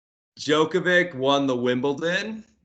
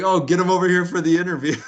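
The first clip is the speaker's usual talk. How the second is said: mock taunt; 7 semitones higher, 6 dB louder, faster than usual. Poirier mocking McGregor.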